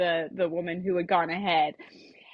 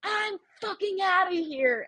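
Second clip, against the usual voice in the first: storming-off declaration; 11 semitones higher than usual. Retold or imitated. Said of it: "I was just like".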